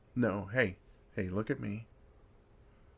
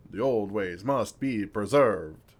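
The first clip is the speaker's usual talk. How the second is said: slow, louder pompous imitation of Victor; mocking Victor; 8 dB louder, slower than usual. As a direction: ham up his solemn decree slowly. Mocking Victor's solemn line.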